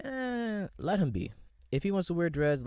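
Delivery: usual speaking voice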